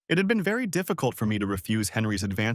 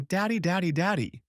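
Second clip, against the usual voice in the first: put-on child's voice; 8 semitones higher than usual. A narrator's reading. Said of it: "hugging me and whispering".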